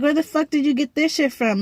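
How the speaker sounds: retold shocked questioning, rattled off quickly; incredulous demand. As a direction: demand an explanation in disbelief. Acted shocked reply to boyfriend.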